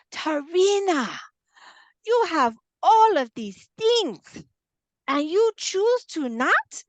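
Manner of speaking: high, loud, slow incredulous scolding; coach's way of talking. Retold or imitated. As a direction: imitate her stretching every word in disbelief. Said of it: "this is how she talks".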